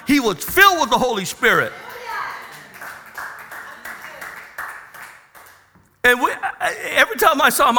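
loud, high, driving preacher's cry; full-force preaching. Acted. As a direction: preach it out to the congregation at full volume. Preaching the testimony climax.

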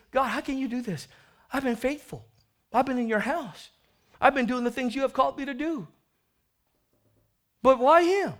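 a touch of self-pitying brother; whining older son. Acted. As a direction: plead your case to God. Older son complaining to God.

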